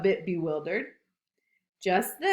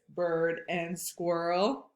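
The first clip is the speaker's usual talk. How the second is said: plain reading voice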